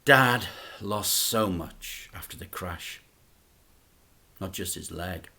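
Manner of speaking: plain reading voice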